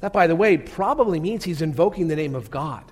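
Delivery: ordinary delivery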